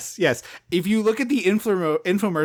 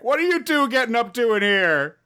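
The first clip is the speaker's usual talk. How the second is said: higher, put-on intruder voice; Paul; 5 semitones higher than usual. Brother bursting in on the couple, mock nosy call. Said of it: "fucking Paul whips the door open and is like".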